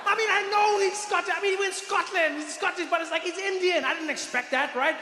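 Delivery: high-pitched, flustered disbelief; can't get over the surprise; incredulous protest. Retold or imitated. Protesting his shock at the accent.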